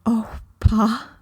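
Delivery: put-on a touch of a tearful, overcome little girl; barely getting the words out, moved; choked-up joy. Laura overwhelmed by Christmas gift.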